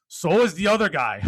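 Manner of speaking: loud, high, punchy sermon-style delivery; fired-up preaching. Acted. Speaker firing up podcast listeners, loud preaching. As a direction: hit it like a pep-talk climax.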